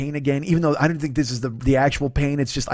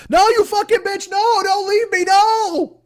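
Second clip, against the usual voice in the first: much higher, desperate screaming plea; Catherine Martin panic; 3 dB louder, slower than usual. Retold or imitated. Trapped woman begs from the well, high panicked scream. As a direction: scream up from the well begging not to be left. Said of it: "I'm Catherine Martin in The Well".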